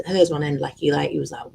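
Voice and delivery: normal talking voice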